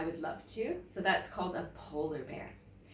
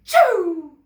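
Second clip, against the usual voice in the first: big loud acted sneeze; 10 semitones higher, 16 dB louder than usual. Polar bear sneezing in the trees, loud acted sneeze. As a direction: explode into a sneeze. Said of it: "he said with surprise".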